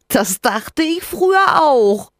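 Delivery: slow, high laughing creature voice. Acted. Rinus laughs as he answers Sophia. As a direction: chuckle through it like a tiny sprite. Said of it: "lacht Rinus".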